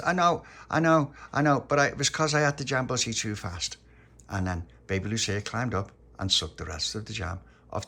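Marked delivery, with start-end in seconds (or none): 0.0-3.8 raised, loud excuse, easing off; lightly voiced Tony, flustered. A narrator's reading. Tony explaining jam on his cheek, lightly flustered. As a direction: protest that you know, then explain.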